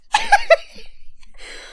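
squeaky giggle of disbelief; giddy laugh; 1 high delighted laugh. Real, not acted. Surprise pull.

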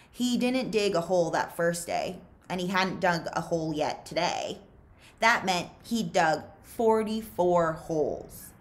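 plain reading voice

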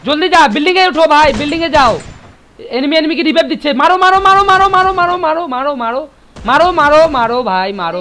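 frantic yelled callouts; excited shouting. Real, not acted. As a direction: shout urgently at teammates. Frantic callouts during a firefight.

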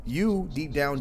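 ordinary talk, nothing marked

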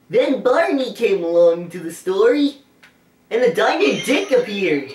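put-on goofy kids'-show dinosaur narrating; Barney voice. Player telling the story as Barney, character voice. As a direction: tell it in a put-on cartoon voice, giggle after. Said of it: "[name] does his Barney voice".

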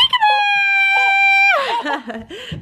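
loud, high shrieking laugh of delight; high squealing laugh. Real, not acted. Finding her little one behind the chair, a high shrieking laugh. Finds child hiding.

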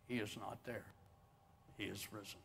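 very soft, low proclamation; hushed declaration. Real, not acted. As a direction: let it drop to a reverent hush. Hushed resurrection declaration in sermon.

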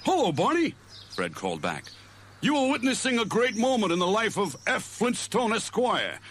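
high puffed-up caveman voice; cartoon Fred, pompous. A narrator's reading. Fred boasts about his rod to Barney, pompous cartoon voice. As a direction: show off grandly. Fred's voice in the story.

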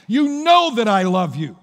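ready to go toe-to-toe, fed up. Retold or imitated. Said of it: "Peter's getting exasperated".

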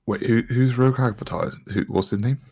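normal talking voice

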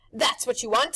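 loud, high showman pitch; pushy grinning tempter; Rictus's brash voice. A narrator's reading. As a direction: sell it like a carnival barker.

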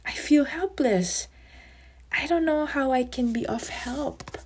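a touch of distress; upset, helpless ant. A narrator's reading. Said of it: "Ant starts now getting upset".

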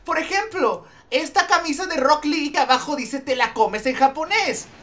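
high, bright TV-sales voice; infomercial host. Pretend. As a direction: pitch the shirt like a TV ad. Mock infomercial showing a shirt. (presentador de teletienda)

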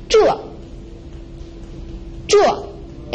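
zhuo short, from high straight down; falling tone. Shown as a demonstration. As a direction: drop zhuo sharply from a high start. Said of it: "The fourth tone is a falling tone".

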